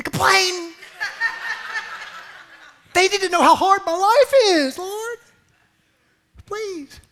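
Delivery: hammed-up self-pity to God; mock whiny complainer. Preacher mimics a believer begging to complain.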